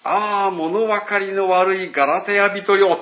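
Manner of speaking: loud, slow lament to the Galatians; Paul's exasperated cry. Retold or imitated. Preacher voicing Paul rebuking the Galatians, loud and slow. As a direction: call out in loud, slow dismay. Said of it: "パウロはこう言うんです".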